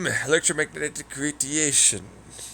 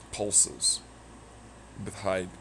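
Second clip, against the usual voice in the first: ordinary delivery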